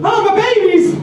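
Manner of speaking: very high frantic wail; tweaking woman. Retold or imitated. Meth-binge woman over dropped coffee, high frantic wail. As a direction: shriek like a mother losing her babies. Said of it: "She goes".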